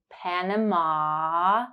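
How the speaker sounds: slow "Panama" with the final ah drawn out; held ah. Shown as a demonstration. Teacher drawing out the ah in "Panama" for learners. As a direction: stretch the ending ah of "Panama". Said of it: "To practice the ah sound".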